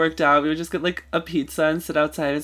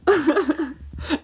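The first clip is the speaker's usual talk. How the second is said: high laugh; 10 semitones higher, 3 dB louder than usual. Real, not acted. Reacting to story.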